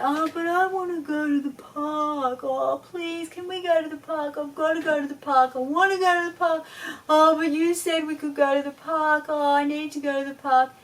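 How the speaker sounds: put-on high, loud, nagging little-kid whine; whining child. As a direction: beg for the park like a tired toddler. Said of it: "that continuous whining".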